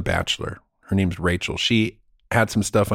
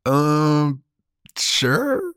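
hesitant sure; 2 semitones higher than usual. Retold or imitated. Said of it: "it's Bob".

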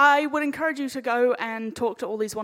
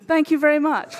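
loud thanks; 4 semitones higher, 9 dB louder than usual. Real, not acted. Closing the panel to applause.

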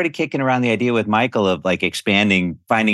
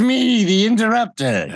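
The Interrupter voice; 11 semitones higher, slower than usual. Retold or imitated. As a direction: announce yourself grandly and high. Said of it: "I was like".

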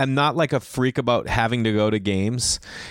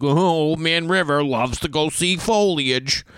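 mock old man; 5 semitones higher, slower than usual. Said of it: "It's not like I'm an old man".

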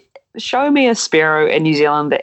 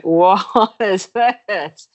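retold astonishment; 3 semitones higher, much slower than usual. Acts out shock at the catch.

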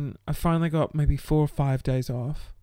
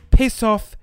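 louder sharp piss off; angry outburst; 3 semitones higher, 9 dB louder than usual. Real, not acted. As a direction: snap it out, fed up.

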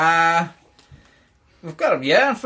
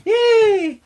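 mock cheer; 4 dB louder than usual. Host cheers himself on.

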